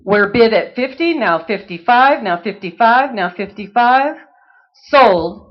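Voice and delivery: rapid rhythmic bid chant; auctioneer. Acted. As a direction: rattle off bids like an auctioneer.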